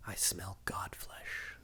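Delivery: low, slow hissing whisper of a villain; eerie whispered threat; creepy whisper. Retold or imitated. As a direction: breathe it like a menacing god. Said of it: "and he says".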